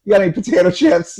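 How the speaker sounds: high, quick, matey question; trying to be buddies. Retold or imitated. Teen making friends with the driver, over-friendly. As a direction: chirp it fast, imitating forced friendliness. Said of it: "I'm trying to be buddies".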